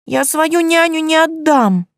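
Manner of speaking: put-on high, slow, huffy little girl; kitten voice. A narrator's reading. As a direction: huff it out like a kitten. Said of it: "Фырчит, как котёнок".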